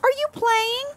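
high, sing-song voice for a pet; baby talk to a puppy. Acted. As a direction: coo at the puppy.

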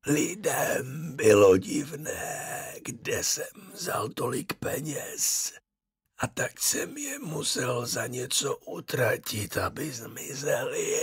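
slow, soft, breathy whisper from the grave; a dead man calling his son, slow and eerie; ghost of the dead father. A narrator's reading. Ghost explains. (pomalý šeptavý hlas ducha mrtvého otce)

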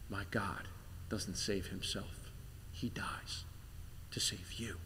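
soft, low whisper. Acted. Preacher tells the puzzled passengers again, in a whisper. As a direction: breathe it out, barely voiced. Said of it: "You whisper it again".

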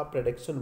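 "प्रोडक्शन" as "pruh-DUK-shun"; correct pronunciation. Shown as a demonstration. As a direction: reduce the first vowel of "प्रोडक्शन" to a schwa. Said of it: "इसका प्रोडक्शन बोलेंगे". ("प्रोडक्शन" का सही उच्चारण, शुरू में "प्र")

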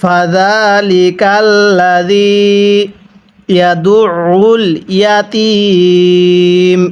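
ইয়াতীম held three alifs long at the pause; three-alif stretch. Shown as a demonstration. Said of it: "মিলিয়ে পড়ুন". (ইয়াতীম তিন আলিফ টেনে)

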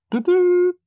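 a sudden high, loud "Tutú" like a whistle; mock whistle blast. Teacher calling Rodríguez to attention, a high loud whistle sound. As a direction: blow it like a drill whistle.

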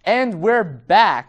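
drawn-out TV host return; slow announcer. Pretend. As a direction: stretch it like a telethon host.

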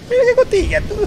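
put-on squeaky baby-talk coo; high cooing voice.

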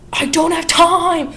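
hammed-up villain shout; mock furious yell, drawn out; angry outburst. Sweeney snaps before killing her.

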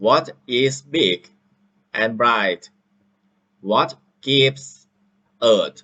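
reading a simple English text slowly and loudly, word by word; a teacher playing a student reading into the app; slow loud read-aloud. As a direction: sound out each phrase like a pupil reading aloud. Teacher reads passage as student.

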